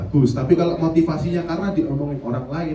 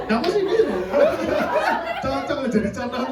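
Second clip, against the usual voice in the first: real laugh, pitched much higher; 10 semitones higher than usual. Real, not acted. Preacher laughs teasing a student who fits the example, laughing. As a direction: burst out laughing while teasing.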